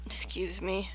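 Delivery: soft whispered excuse me. Real, not acted. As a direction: whisper it, unvoiced. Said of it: "I'm eating".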